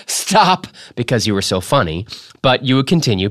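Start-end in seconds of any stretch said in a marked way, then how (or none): 0.1-0.7 a touch of a mother helpless at the joke; higher, lightly voiced plea to stop; mother begging stop. A narrator's reading. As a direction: mildly imitate a mother begging a joker to quit. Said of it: "wanted her first to say".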